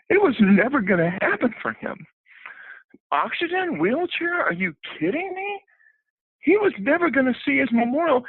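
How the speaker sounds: pressing the point with rising passion; impassioned plea. Retold or imitated. Retelling his urgent plea to the film crew.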